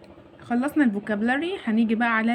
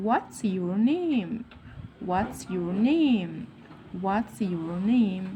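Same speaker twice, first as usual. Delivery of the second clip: very slow "What's your name", NAME stretched; slow modelling; 3 semitones lower, 3 dB quieter, much slower than usual. Shown as a demonstration. Teacher shows how to ask a classmate's name, slow and drawn-out. Teacher drills question slowly.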